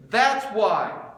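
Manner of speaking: loud, high and driving, preaching hard; full-force preaching. Acted. Pastor to his congregation, preaching loud and hard. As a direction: preach it out to the whole room.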